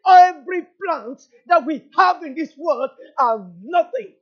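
loud, high, driving sermon delivery; full-force preaching. Real, not acted. Preacher declaring the Lord's words to the congregation, full-force preaching.

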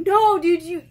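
loud, high burst of delight; excited outburst. Real, not acted. Opening surprise gift package.

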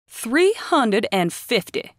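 loud, slow angry holler. Acted. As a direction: bellow the bid, mad and red. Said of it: "hollered Tucker".

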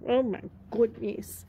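ordinary talk

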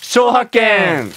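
slow, high, stretched-out announcement; drawn-out verdict call. Acted. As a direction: draw it out like a game-show verdict.

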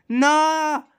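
high, loud panicked cries; panicked refusal. Pretend. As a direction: shriek it as danger lands on you. Dangerous gift from game villain.

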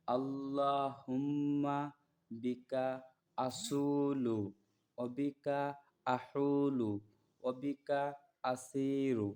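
slow, drawn-out dua recitation, each word held; teacher reciting a travel dua word by word; slow Arabic recitation. Shown as a demonstration. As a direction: recite the Arabic prayer slowly for learners to repeat. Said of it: "তো আবার পড়তেছি দোয়াটি".

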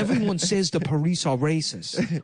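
mock foreign-accented politician; Andrew Yang. Presidential candidate announcing a giveaway, mock foreign accent. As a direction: announce policy in a put-on broken-English accent. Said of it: "learn English, Yang".

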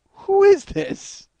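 high, slow, startled question; mock-startled, drawn out and high; half-asleep surprise at the phone; startled who is this. Answering a late phone call.

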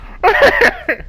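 short high cackling laugh. Real, not acted. Player laughs while hunting for teammate, high burst.

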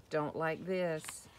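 ordinary delivery

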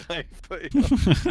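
drawn-out echo. Pretend. Echoes the phrase mockingly.

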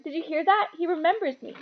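high-pitched, thrilled announcement; excited cry. A narrator's reading. Thrilled man telling everyone he's remembered, excited cry. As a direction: tell the whole room in a high, delighted voice. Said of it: "cried Dedalus Diggle, looking around at everyone".

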